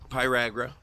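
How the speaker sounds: ordinary talk, nothing marked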